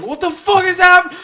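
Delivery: very high, loud mock shriek; panicked scream. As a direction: scream it like you've just been sent flying. Voicing players sent flying.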